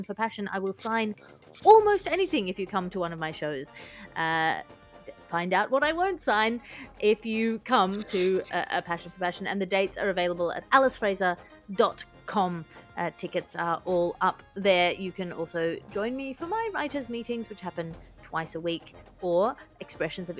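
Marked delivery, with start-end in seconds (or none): none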